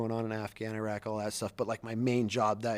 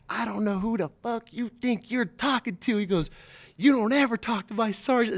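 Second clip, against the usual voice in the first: high-pitched mock dressing-down; retold angry chewing-out; 9 semitones higher than usual. Master gunny defends his sergeant, acted angry reprimand. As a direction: imitate a protective boss tearing into someone. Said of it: "It just rips her a new one".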